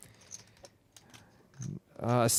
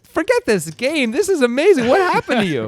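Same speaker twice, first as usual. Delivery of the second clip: over-the-top amazement; 12 semitones higher, 9 dB louder than usual. Pretend. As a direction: squeal like it's a miracle. Hamming up amazement at partner.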